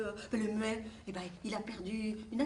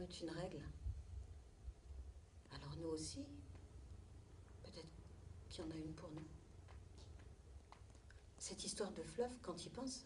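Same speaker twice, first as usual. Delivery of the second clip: soft tender farewell; 3 semitones lower, 10 dB quieter than usual. Acted. Mother quietly reassuring Gould before he leaves, soft whisper. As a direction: murmur it close, gently.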